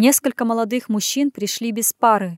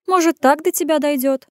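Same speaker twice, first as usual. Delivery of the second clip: needling sister; 6 semitones higher, 4 dB louder than usual. Acted.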